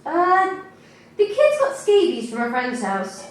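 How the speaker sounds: Alyssa's voice. A narrator's reading. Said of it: "Alyssa replied".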